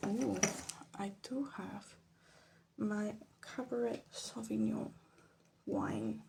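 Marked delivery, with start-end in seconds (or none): none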